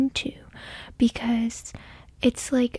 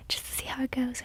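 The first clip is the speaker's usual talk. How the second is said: whispering; 10 dB quieter, much faster than usual. Real, not acted. ASMR tarot whisper.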